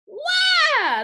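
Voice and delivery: high, loud drawn-out wail of alarm; weaver's shout. A narrator's reading. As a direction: yell out high and long in fright. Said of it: "the weaver shouted".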